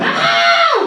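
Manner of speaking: very high, sustained imitated scream; panicked scream. A narrator's reading. Women seeing the men shot, screaming in panic. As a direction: let out one long shrieking "ah". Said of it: "They're screaming in pure panic".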